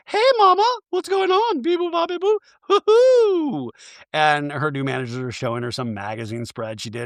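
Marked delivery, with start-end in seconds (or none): 0.1-3.7 very high-pitched mock-chirpy caricature; ditzy reality-star send-up, far above normal pitch; Dorit. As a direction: squeal it out in a silly falsetto. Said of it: "And Dorit's like".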